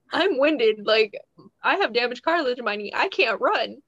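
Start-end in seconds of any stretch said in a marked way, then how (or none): none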